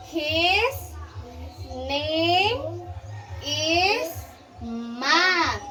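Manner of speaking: slow, spaced reading; slow school dictation. Shown as a demonstration. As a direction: read it slowly for a child. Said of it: "Listen carefully".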